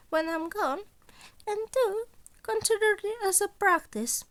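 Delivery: put-on sly, amused old mentor toying with his student; Nezu voice.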